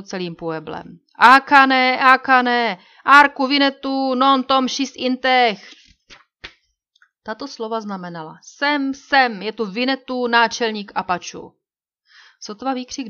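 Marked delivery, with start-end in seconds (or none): 1.2-5.7 loud, high call that echoes; ringing shout. A narrator's reading. Guard shouting across the pueblo, loud ringing call. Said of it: "jeden z nich zvolal, až se to rozlehlo". 8.4-11.5 loud calling out; translated shout. A narrator's reading. Narrator giving the guard's shout, loudly called. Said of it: "zvolal, až se to rozlehlo".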